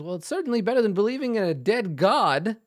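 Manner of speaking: a smug dwarf jab; lightly voiced dwarf quip; old dwarf. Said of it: "He nods and he says".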